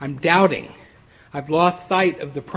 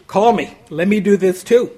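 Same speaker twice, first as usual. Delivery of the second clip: louder, eager plea to be called; eager bold Peter; 3 semitones higher, 8 dB louder than usual. Acted. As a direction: Peter blurting out his dare, louder. Peter boldly asking Jesus.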